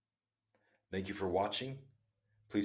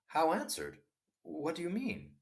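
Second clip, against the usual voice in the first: puzzled; 11 semitones higher than usual. Acted. King puzzled, questions hermit.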